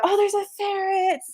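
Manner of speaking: high-pitched mock stage holler; high, held-back pretend shout; retold shout. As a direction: imitate a frontman calling out, without full volume. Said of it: "shouted out".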